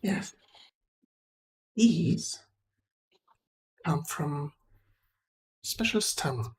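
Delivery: hushed, halting mystic, half whispered; secretive trader. Acted. Trader telling Fenris about special glowing stones, hushed and secretive. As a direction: reveal it softly in small pieces with long pauses.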